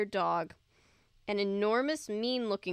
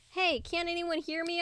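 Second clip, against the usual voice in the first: higher, frightened call for help; frightened cry; 8 semitones higher than usual. A narrator's reading. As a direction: cry out, scared and alone. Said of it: "My voice came out shrill and frightened".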